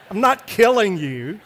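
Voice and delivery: loud, emphatic retold objection; loud protest. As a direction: call it out over fake screaming. Father calls out over son's screams.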